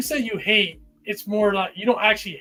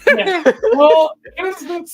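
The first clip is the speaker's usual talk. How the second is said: high laughing voice; 9 semitones higher, 9 dB louder, slower than usual. Real, not acted. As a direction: talk through the giggles. Laughing after a joke.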